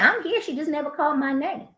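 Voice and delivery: put-on high childlike protest, trailing soft; a small kid explaining himself; little boy. Junebug tells receptionist, high childlike voice. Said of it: "Junebug's like".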